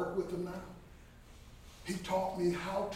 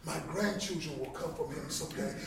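ordinary delivery

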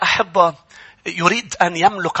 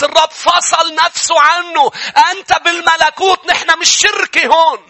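rising, higher and louder preaching that ends in shouts; preaching at full force; 9 semitones higher, 4 dB louder than usual. Acted. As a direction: drive the sermon up to a fervent peak. (وعظ بحماس متصاعد)

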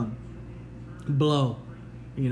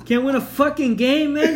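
louder, higher rising taunt; mocking Philadelphia's team with laughter; laughing rant; 5 semitones higher, 5 dB louder than usual. Real, not acted. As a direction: taunt them with mounting glee. Ranting at Philadelphia.

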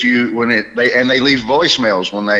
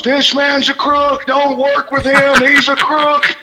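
much higher, slow screamed accusation; screaming; slower than usual. Retold or imitated. Comic at a convention denouncing a crooked agent, screaming. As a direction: scream it across the hall at the crook. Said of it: "I just started screaming at the top of my lungs".

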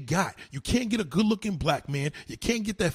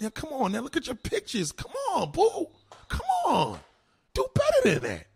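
mocking taunt; 10 semitones higher than usual. Real, not acted. Taunting her about her pictures.

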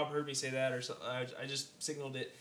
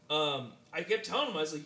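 normal talking voice

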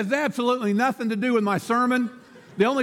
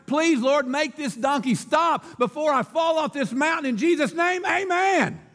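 higher, climbing pleading prayer; desperate prayer; 7 semitones higher than usual. Acted. Rider near the cliff prays aloud, desperate climbing plea. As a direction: beg the Lord, rising as you go. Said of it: "the man cried".

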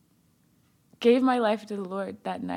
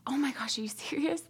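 gasp, then high, hushed disbelief; imitated breathless astonishment, softer; shocked disbelief; 5 semitones higher, 7 dB quieter than usual. Sister hearing surprising news on the phone, shocked disbelief.

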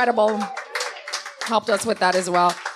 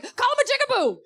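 laughing suggestion; 13 semitones higher, faster than usual. Retold or imitated.

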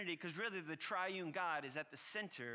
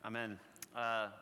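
usual speaking voice

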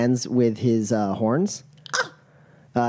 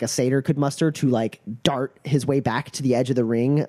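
ordinary talk, nothing marked

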